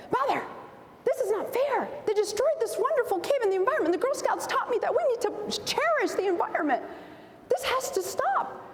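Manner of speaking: put-on high, fast, indignant little girl's voice; a child protesting to her mother, high and fast. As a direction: play her younger self outraged at the vandals.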